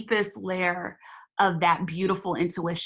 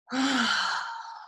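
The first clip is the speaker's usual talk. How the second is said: sigh; 5 semitones higher than usual. Shown as a demonstration. Guided breathing release.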